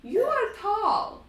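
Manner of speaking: put-on high, slow child voice; a small boy looking way up; little Strahd. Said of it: "he turns and he looks at Strahd and he says".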